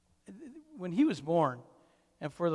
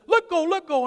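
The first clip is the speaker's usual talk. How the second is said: high, loud mock yell; urgent high kid shout, acted; child's protest; 12 semitones higher, 8 dB louder than usual. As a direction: imitate a boy yelling in a tug-of-war. Retold grandson yelling in tug-of-war.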